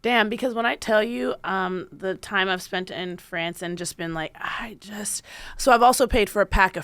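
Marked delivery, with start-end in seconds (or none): 1.4-5.2 hushed, breathy confession under the breath; whisper. Real, not acted. Admitting to a friend she never learned French, whispered. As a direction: whisper it like a guilty secret.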